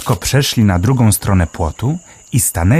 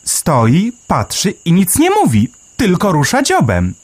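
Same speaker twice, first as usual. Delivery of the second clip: a small bird marvelling, pitched way up; Melek the sparrow; 10 semitones higher than usual. A narrator's reading. Sparrow Melek marvelling underground, high chirpy voice.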